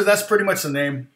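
normal talking voice

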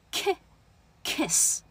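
kiss built from "ki", short lax i; short vowel. Shown as a demonstration. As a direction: model ki, then kiss, with a brief relaxed vowel. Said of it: "它不是一个长元音啊".

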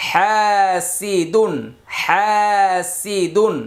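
হা held long, সি দুন short; slow syllable recitation, drawing out হা; madd stretch. Shown as a demonstration. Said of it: "একটি করে মাদের হরফ পাবো".

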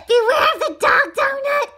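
high, loud, giggly repeat; laughing squeal. Acted. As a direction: shout it in a squeaky voice through laughter.

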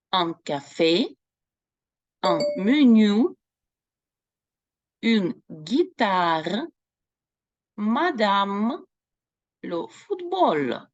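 slow, careful Un café, un menu; teacher reading French words. Shown as a demonstration. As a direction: read each word slowly for the class. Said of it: "Я вам зараз прочитаю".

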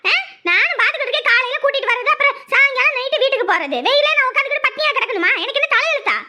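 high, indignant tirade; angry shouting. Acted. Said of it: "இப்ப எதுக்கு கத்துற".

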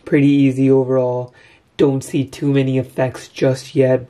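usual speaking voice

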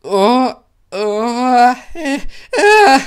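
high, loud panicked cry; terrified little engine screaming. A narrator's reading. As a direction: cry out in terror. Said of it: "schrie Thomas".

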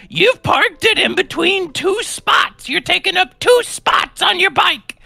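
put-on high, slow, screechy old lady yelling; hammed-up high old woman voice; cranky old woman. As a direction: shriek it like an angry old neighbor. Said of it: "She's like".

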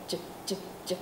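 voiceless, breathy ch puffs; whispered ch. Shown as a demonstration. Teacher shows the throat-silent ch. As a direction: hiss the ch without voice. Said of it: "Cổ không rung nè".

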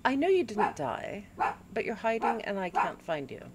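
ordinary delivery

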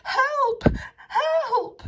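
loud, very high cries for help; wailing storybook scream for rescue; panicked wail. A narrator's reading. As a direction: cry out in terror, high and full. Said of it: "he wailed".

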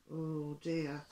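ordinary delivery, nothing marked

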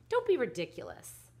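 high, loud scolding; acted exasperated mother; dismissive. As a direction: brush off the complaint firmly. Mother dismisses daughter's accusation.

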